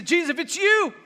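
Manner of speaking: calling out high and loud across the distance; Peter. Acted. Disciple calls from the boat to Jesus on the water, calling out. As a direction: project it upward, a bold call to someone far off. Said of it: "Peter calls out to Jesus".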